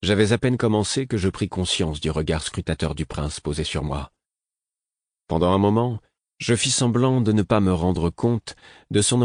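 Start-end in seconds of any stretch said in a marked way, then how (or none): none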